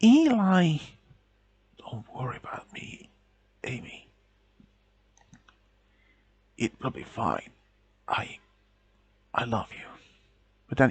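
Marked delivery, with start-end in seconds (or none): 0.0-0.8 anguished cry. Acted. Girlfriend cries out his name. 1.8-9.8 weak whisper. A narrator's reading. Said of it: "words didn't come out strong enough".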